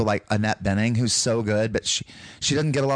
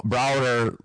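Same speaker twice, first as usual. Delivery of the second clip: Texas accent; 2 semitones higher than usual. Shown as a demonstration. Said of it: "Texas is wider and out there".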